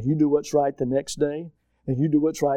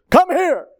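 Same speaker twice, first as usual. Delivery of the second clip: higher and louder, sharp order; commanding shout of come here; barked command; 11 semitones higher, 7 dB louder than usual. Shown as a demonstration. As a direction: snap it out like a drill order. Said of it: "Or you can say".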